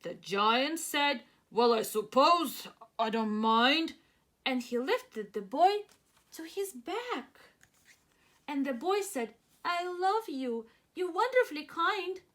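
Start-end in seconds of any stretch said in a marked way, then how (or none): 1.5-3.9 a gruff giant giving in. Acted. 9.6-12.2 put-on higher, sweet boy voice; a grateful boy to the giant; little boy.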